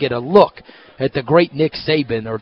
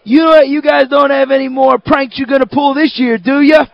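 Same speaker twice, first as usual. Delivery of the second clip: high, louder teasing guard voice; guard teasing about pranks, higher and louder; security guard; 10 semitones higher, 5 dB louder than usual. Retold or imitated. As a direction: needle him like a knowing guard. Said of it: "And he goes".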